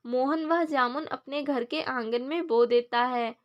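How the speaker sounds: ordinary delivery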